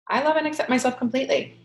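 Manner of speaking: quick, high, upbeat affirmation; bright affirmation. Shown as a demonstration. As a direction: chirp it out cheerfully.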